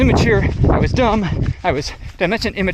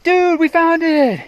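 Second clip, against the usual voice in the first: much higher and slow, drawn-out thrill; excited; 12 semitones higher, 4 dB louder, much slower than usual. Retold or imitated. Friends spotting the mogul run, excited and drawn out. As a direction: savour the discovery. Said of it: "And they're all excited".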